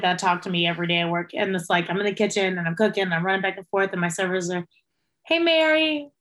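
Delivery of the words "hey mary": wheedling tone. Retold or imitated. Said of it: "you can tell by the tone".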